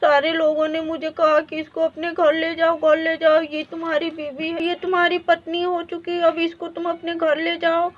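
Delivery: ordinary talk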